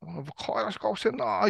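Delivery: put-on family member gushing over a cute boy; gossipy auntie quote; loud nosy osaka auntie. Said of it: "いう話して".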